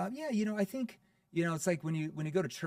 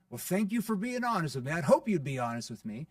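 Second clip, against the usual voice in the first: bright, teasing thank-you, louder and higher; playful tone; 4 semitones higher, 6 dB louder than usual. Shown as a demonstration. Salesman to prospect, playfully thanking them for honesty. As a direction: tease the prospect lightly for being honest. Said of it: "what type of tone did that sound? ... Playful".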